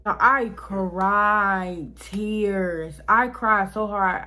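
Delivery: CRIED and TEARS stretched out very slowly; slow, emphatic stretch on CRIED; drawn-out emphasis. Real, not acted. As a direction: drag out "cried tears" word by word. Stressing how hard they cried.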